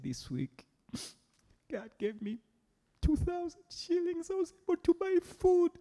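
high, teary voice of a grateful woman; tearful thankful testimony. Retold or imitated. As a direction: retell her choked-up thanks, rising high. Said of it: "and she start crying".

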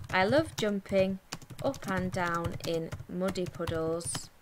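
measured, broken up for writing. Shown as a demonstration. Dictating the sentence while writing.

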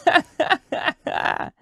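breathless high witch cackle; cackling wicked witch. A narrator's reading. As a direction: cackle like an old hag. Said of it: "cackled the old witch lady".

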